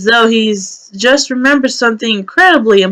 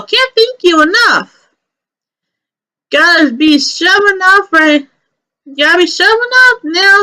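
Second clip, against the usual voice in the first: a higher, sing-song chatterbox rabbit; chatty Aaron voice; 6 semitones higher than usual. Acted. Chatty rabbit seeing the kids off with a higher sing-song voice. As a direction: gush politely while shooing them off.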